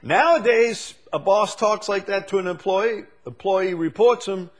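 high, loud preaching cadence; full-force preaching. Acted. Preacher to congregation on rude bosses, full-force preaching.

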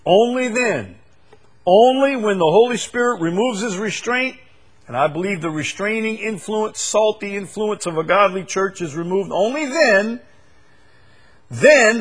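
high, loud, hammering only then; emphatic preaching. Real, not acted. Preacher pressing the congregation on only then. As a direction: drive home the word only.

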